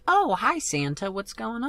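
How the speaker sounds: put-on a small child happily greeting Santa; innocent little child. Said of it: "younger than that, she would have just been like".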